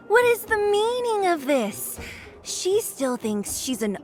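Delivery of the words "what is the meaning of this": high-pitched mimicry of her question; mocking imitation. Mocking the overworld princess to her face, high mimicry. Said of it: "Listen to her".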